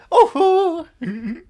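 high, slow, jolly German caricature; sing-song comic German accent; hammed-up German accent. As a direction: play a gleeful Oktoberfest German. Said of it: "And you just go".

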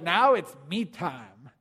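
lazy weary dad wanting to be left alone; mock couch-potato father; passive dad. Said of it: "we're gonna be tempted to just be like".

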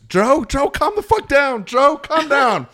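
high loud yelling to calm a brawler; frantic high shout across a scuffle; yelling at Joe. Retold or imitated. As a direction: shout him down, pleading and loud. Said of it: "all you can hear in the tape is".

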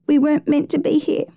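put-on high-pitched, worried child; pleading. A narrator's reading. Trapped girl confessing to her rescuer, pleading. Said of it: "she pleaded".

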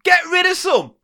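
high, shouted retort; exasperated shout. Acted. Comic row over records, exasperated high shout. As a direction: fire it back at him in mock anger.

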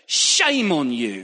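loud, slow scolding "Shame on you"; shaming preacher. Retold or imitated. A preacher scolding his congregation, loud and slow. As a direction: wag the finger and boom it out. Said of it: "I've even heard preachers say it".

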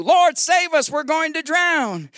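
loud, high mock-desperate plea; panicked cry. Disciples calling on the Lord as they sink, panicked cry. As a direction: cry out for rescue, hammed up.

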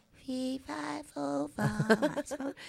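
put-on tiny, high, soft child's voice; little girl voice. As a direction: squeak it out like a timid little girl. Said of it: "my little voice goes".

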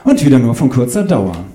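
ordinary delivery, nothing marked

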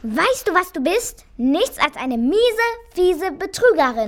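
high-pitched, heated accusation; angry scolding. Acted. Mouse calling the cat a cheat, indignantly scolding.

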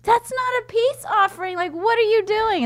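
high, incredulous scolding; high exasperated scolding; exasperated disbelief. Retold or imitated. Exasperated at dad.

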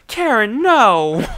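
loud, high wail of protest; mock crying. Distraught man beside the safe guy, hammed-up crying. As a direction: cry it out in hammed-up despair. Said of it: "just, like, crying".

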